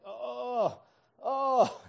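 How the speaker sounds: high, loud imitated moans of pain; mock groaning. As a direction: groan it like she's hurting. Wife groaning in pain, retold.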